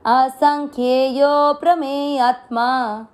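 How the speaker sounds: असंख्येयो with यो held long. Shown as a demonstration. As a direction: hold the यो in असंख्येयो. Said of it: "आपको लंबा खींचना है". (असंख्येयो में यो लंबा खींचकर)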